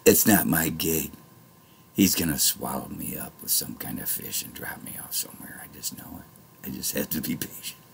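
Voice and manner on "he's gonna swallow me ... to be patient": whisper. Real, not acted. Confiding prophecy to viewers.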